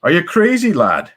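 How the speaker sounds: put-on Banshee's Irish accent. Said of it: "you gotta do the Irish".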